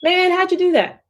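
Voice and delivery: high, amazed friend asking how; hammed-up amazed girlfriend voice; impressed friend. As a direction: gush like a friend who is wowed. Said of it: "Like when they say".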